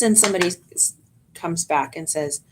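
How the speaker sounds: normal talking voice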